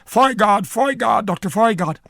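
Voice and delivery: high, loud repeated summoning call; calling offstage. Retold or imitated. Actor summoning the late priest onstage, loud call. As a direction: call the name urgently from the door. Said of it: "Ronald Wallace going".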